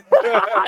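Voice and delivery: real high laugh breaking out; burst of laughter. Real, not acted. Laughing at tortoise joke.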